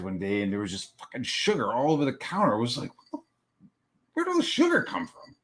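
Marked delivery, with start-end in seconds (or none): 3.1-3.7 whispered disbelief; barely voiced bewilderment; stunned reaction. Retold discovery of spilled sugar. 4.1-5.3 very high, squeaky question; falsetto-high incredulity; baffled disbelief. Retold or imitated. Retold discovery of spilled sugar.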